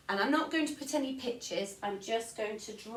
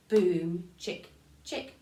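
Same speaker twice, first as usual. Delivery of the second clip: loud "Boom", then whispered "chick, chick"; 4 semitones lower, 4 dB louder, slower than usual. Shown as a demonstration. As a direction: punch "Boom", breathe "chick, chick". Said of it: "three crotchet beats in a bar".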